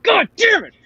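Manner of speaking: high-pitched, hammed-up indignation; outraged fan. Acts out outrage at Lando's treatment.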